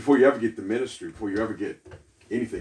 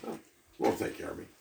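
hushed, breathy plea to God; whispered prayer; 9 dB quieter than usual. Retold or imitated. Widow's prayer voiced in story.

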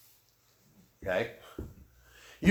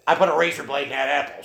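blunt retort; 6 dB louder, faster than usual. Retold or imitated. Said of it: "she just speaks brutally".

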